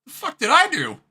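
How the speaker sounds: high, loud, baffled squeal; incredulous squeak. Retold or imitated. Streamer playing the blamed man, high and loud. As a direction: squeal it like you're wrongly blamed. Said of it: "I'm like".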